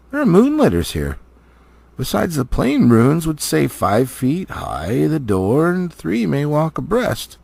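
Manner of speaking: lightly voiced stately elf lord, grave and proud; a touch of an old elf lord naming ancient blades; Elrond, wise elf lord. Elrond tells Thorin and Gandalf of the swords, stately. Elrond reading moon letters.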